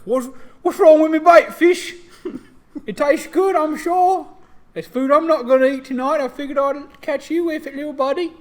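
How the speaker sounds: high, loud Cockney British orc voice. Acted. Orc teasing a fish at his line, Cockney. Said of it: "orcs in this world have a very Cockney British accent".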